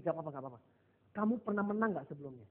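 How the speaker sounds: soft, low-key comforting question; quiet, soothing retold reassurance; reassuring coach. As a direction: gently put a nervous athlete at ease. Coach reassures the nervous athlete.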